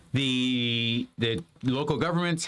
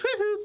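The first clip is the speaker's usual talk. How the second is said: mad king whoop. Retold or imitated. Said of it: "He goes".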